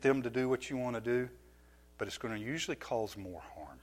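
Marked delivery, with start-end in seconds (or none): none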